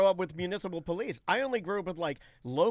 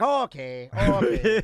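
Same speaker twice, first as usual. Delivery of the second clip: put-on a light Irish accent, brushing him off; lightly voiced Irish cop, dismissive; mock Irish policeman waving it off, louder on okay; 7 dB louder, slower than usual. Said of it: "what I call the Irish cop".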